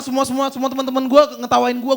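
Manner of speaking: ordinary delivery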